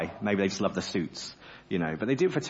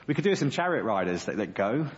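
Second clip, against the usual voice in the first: usual speaking voice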